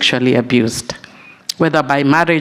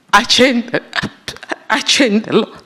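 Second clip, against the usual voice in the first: real tears, voice cracking and pitched higher; fighting back tears as the words catch; voice breaking; 6 semitones higher than usual. Real, not acted. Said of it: "I get emotional".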